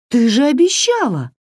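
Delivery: put-on high, upset girl's protest; wounded, high-pitched objection; indignant protest. A narrator's reading. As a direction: cry foul in a high voice. Varya protests betrayal.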